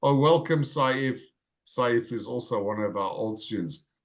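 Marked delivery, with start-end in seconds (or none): none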